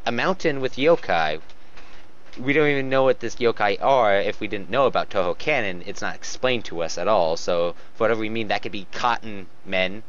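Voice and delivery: ordinary talk, nothing marked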